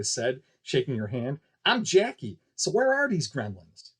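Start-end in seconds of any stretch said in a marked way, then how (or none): 1.7-3.9 put-on higher, eager kid; a small boy introducing himself; lightly voiced boy. Jackie asking Kiki about gremlins, eager and high.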